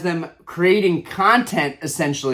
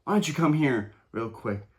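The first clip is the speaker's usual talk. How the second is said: quiet, coaxing imitation of the streamer; soft and sly, calling the kid over; 4 semitones lower, 8 dB quieter than usual. Vlogger mimics streamer quietly luring his kid over. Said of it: "the streamer goes".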